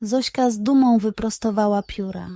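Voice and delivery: plain reading voice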